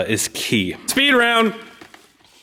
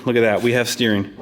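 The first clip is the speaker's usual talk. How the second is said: ordinary talk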